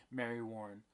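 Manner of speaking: ordinary delivery, nothing marked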